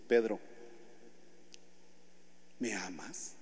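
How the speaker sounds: low, soft and tender; Jesus gently asking Peter if he loves him. Acted. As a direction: ask with quiet love.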